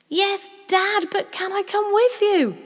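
put-on very high, loud child's plea; urgent pleading. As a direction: beg impatiently to be included. Child pleads with distracted father.

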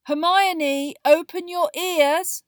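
very loud, high, slow exasperated call; loud Ron. A narrator's reading. Boy telling friend to open her ears, loud. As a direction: hammer it out loudly. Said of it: "said Ron loudly".

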